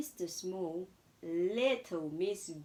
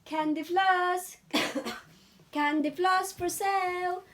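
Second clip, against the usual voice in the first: street vendor's cry; 9 semitones higher, 7 dB louder than usual. A narrator's reading. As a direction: call out your wares across a fair. Vendor crying wares at the fair.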